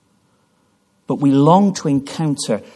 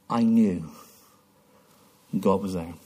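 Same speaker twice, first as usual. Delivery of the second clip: low, soft, hushed awe; the deacon recalling God's presence; awed testimony; 8 semitones lower, 8 dB quieter than usual. Retold or imitated. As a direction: breathe it out in reverence.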